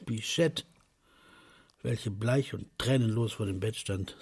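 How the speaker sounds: usual speaking voice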